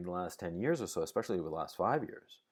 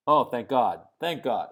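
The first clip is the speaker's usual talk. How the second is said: relieved; 8 dB louder than usual. Real, not acted. Relieved viewers still listening.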